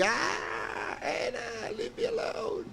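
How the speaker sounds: very high, mock-frightened squeak; mock scared squeak. Pleading with Anna to leave him alone, mock scared. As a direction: beg her off in a squeaky pretend panic.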